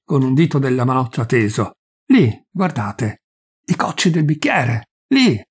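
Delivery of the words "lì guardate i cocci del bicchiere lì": ordinary delivery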